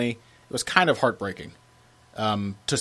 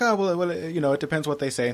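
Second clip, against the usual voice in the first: higher-pitched, badly faked Irish accent; Conor McGregor accent; 6 semitones higher than usual. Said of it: "That's my horrible Conor McGregor accent".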